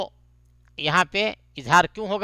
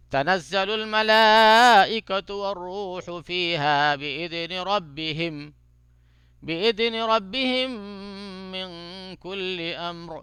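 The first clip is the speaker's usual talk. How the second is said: slow, drawn-out melodic tajweed chant; Quran recitation; much slower than usual. Shown as a demonstration. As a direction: chant each phrase slowly with long vowels. Recites first way.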